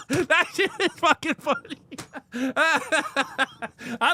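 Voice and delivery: high-pitched, talking through laughter; helpless laughter in the voice; real laughing. Real, not acted. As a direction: crack up while saying it. Cracking up at quoted scene.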